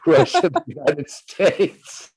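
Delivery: high-pitched, giggling through the words; real laughter breaking into the phrase; laughing. Real, not acted. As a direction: laugh at your own slip while saying it. Laughs at own slip.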